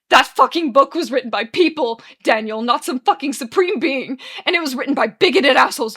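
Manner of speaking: loud, scornful ranting; furious tirade. Acted. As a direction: rail against the book at full volume. Arthur rages in quarrel.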